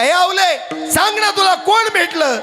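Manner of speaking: high, insistent call to wife; urgent questioning. Acted. Tukoba आवलीला आतुरतेने विचारतात. (आतुरतेने, चढ्या आवाजात विचारत)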